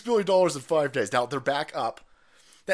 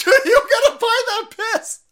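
much higher, loud laughing jeer; laughing taunt; 10 dB louder than usual. Real, not acted. Taunting opponents who must buy the beer, laughing. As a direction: cackle the taunt out.

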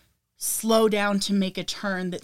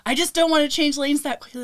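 imitating boyfriend; 8 semitones higher, 7 dB louder than usual. Quoting boyfriend's reluctance.

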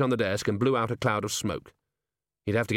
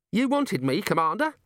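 put-on bright young dwarf; 10 semitones higher than usual. Cheery voiced in reading.

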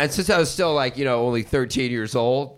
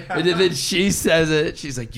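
ordinary delivery

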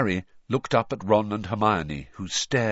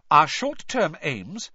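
raised, deliberate, higher-pitched declaration; talking over someone; 6 semitones higher, 4 dB louder, slower than usual. A narrator's reading. Hermione ignoring Ron's objection, loudly. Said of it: "speaking even more loudly than Ron".

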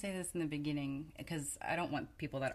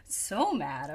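louder, playful echo of anger; mock-mad mirroring; 7 dB louder than usual. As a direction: echo the child's outrage with mock intensity. Parent mirrors daughter's anger back.

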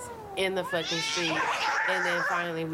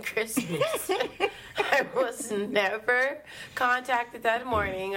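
laughing through the words, slow and higher; amused disbelief, spoken while laughing; 5 semitones higher, slower than usual. Real, not acted. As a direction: laugh it out, drawn out and a little higher. Laughing at own story.

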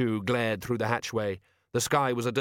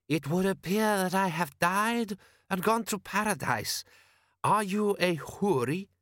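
put-on slow, high-pitched Klatchian-accented voice; 9 semitones higher, slower than usual. Wounded man greeting a vision of paradise, Klatchian accent.

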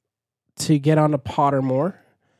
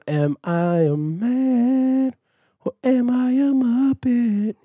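slow, higher put-on voice rising at the end; Muppet movie song line; 9 semitones higher, much slower than usual. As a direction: ask it slowly, climbing up, like Walter. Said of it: "It's the one".

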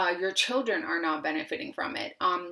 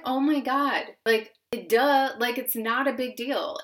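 higher, louder self-mocking "duh" moment; mock exasperated realisation; 5 semitones higher, 5 dB louder than usual. Woman recalling her realisation after taking the leap, mock exasperated. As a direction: voice a sudden obvious realisation, pitched up and punchy.

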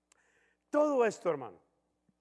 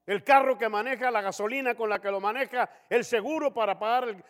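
exasperated father; 2 semitones higher, 8 dB louder than usual. Retold or imitated. Father lists what he gives.

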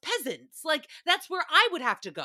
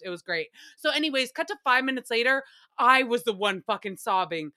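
usual speaking voice

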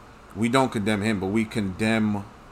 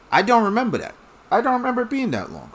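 much higher, sneering mimicry; mocking high voice; 12 semitones higher, faster than usual. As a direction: parrot them in a silly voice. Mocks people who just accept it.

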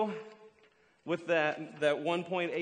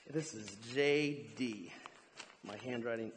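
normal talking voice

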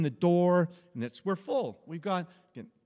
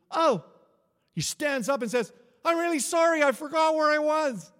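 higher, louder, hammed-up apology; apologetic drunk man; 10 semitones higher, 7 dB louder than usual. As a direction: lightly play the startled drunk saying sorry.

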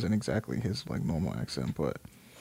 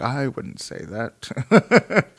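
very slow, drawn-out imitation; Johnny Depp; much slower than usual. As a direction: stretch it out like a careful celebrity witness. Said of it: "And he's like".

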